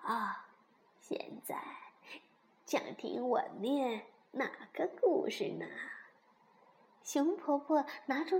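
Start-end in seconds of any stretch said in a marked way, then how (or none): 0.1-5.7 put-on soft, halting old granny voice; 熊婆婆，慈祥安慰. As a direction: reassure the children gently, with long pauses. (婆婆轻声慢慢地安慰)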